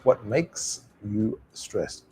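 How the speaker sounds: slow "What... makes... you... stressed", gaps between words; careful, unlinked word-by-word demo; each word separate. Shown as a demonstration. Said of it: "pronounce each word separately".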